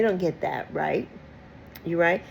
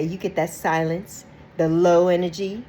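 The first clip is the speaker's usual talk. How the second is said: ordinary talk, nothing marked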